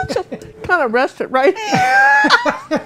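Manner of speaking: slow words through loud laughter; laughing loudly. Real, not acted. Laughing while tucking mic.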